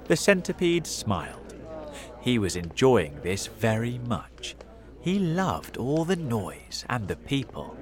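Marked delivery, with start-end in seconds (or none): none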